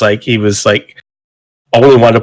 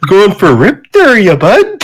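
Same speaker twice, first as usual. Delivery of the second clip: put-on Canadian accent; 8 semitones higher, slower than usual. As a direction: ham up a Canadian hoser. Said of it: "That's so great".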